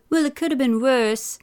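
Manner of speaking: insisting. A narrator's reading. Boy defends himself to Dad, insistent. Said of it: "Eric insisted".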